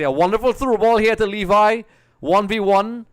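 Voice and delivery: high, loud call of an attack; excited live commentary. Real, not acted. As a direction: urgently call the striker through on goal.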